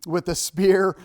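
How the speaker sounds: badly faked Scottish warrior accent; Braveheart accent. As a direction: throw out a hammy Scottish phrase. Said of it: "quite possibly the worst accent".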